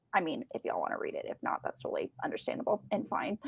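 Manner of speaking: tossed-off murmur to the audience; quick hushed afterthought for listeners; whispered aside. Real, not acted.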